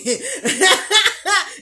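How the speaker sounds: high burst of cackling laughter; a real delighted squeal of laughter; loud gleeful laugh. Real, not acted. Gleeful laugh at own trick.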